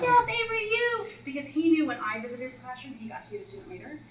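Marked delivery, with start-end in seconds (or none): 0.0-1.1 high, loud excited call; a thrilled child picking her out; eager kid shout. Retold or imitated. Child calling to the visitor, excited and loud.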